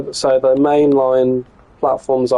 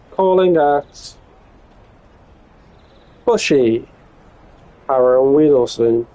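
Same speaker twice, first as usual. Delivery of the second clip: conductor mode. Pretend. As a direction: announce each stop like a train conductor. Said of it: "we'll do a conductor mode".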